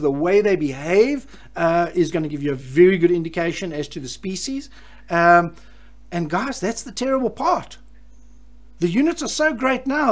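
normal talking voice

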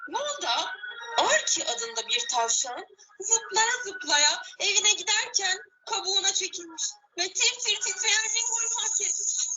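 ordinary delivery

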